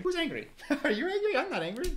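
high-pitched, laughing protest; giggly denial. Pretend. Viewer insisting they're not angry, giggly denial.

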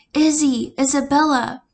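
loud, high calls across the rain; calling out. Acted. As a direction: yell her name to reach her. Parents spot daughter in rain.

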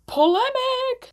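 high, slow sung-out exclamation; drawn out like a gasp of scandal; teasing fans, hammed-up high and slow; mock dramatic. Teasing fans about debate.

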